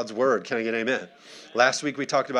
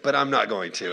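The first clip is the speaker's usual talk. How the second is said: ordinary delivery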